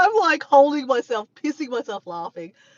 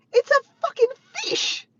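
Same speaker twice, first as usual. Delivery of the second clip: very high-pitched mock yell; high, squealed retelling of an outburst; imitated exasperation; 3 dB louder than usual. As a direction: mimic an exasperated shout. Retold exasperated reply about the fish.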